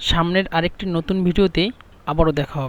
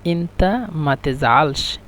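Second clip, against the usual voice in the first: slow demo; much slower than usual. Shown as a demonstration. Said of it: "মিশরের ভাষা হলো".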